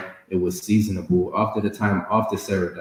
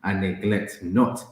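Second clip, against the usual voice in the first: slow scripture reading; 2 semitones higher, slower than usual. Acted. Reads scripture aloud.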